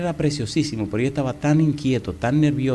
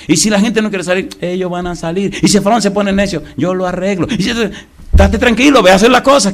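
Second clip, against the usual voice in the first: louder, higher, insistent answers; God reassuring Moses; 7 semitones higher, 6 dB louder than usual. Acted. God to Moses, loud and insistent. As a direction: brush off every worry with force.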